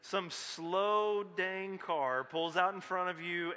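usual speaking voice

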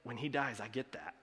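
put-on sheepish child's thought. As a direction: tell the greedy kid's thought under your breath. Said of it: "of saying".

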